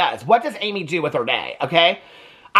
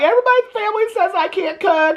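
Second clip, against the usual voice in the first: mock crying whine; 13 semitones higher, faster than usual. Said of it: "Amy's crying 'cause she's like".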